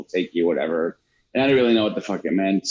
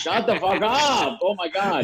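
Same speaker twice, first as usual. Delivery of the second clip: mock exasperation; 8 semitones higher than usual. Kid answering whining mom, mock exasperated.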